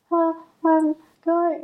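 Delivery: high, slow, timid little voice; acted scared. Shown as a demonstration. Showing how she nervously excused herself from the stranger, mock scared. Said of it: "when I'm scared, I said it like".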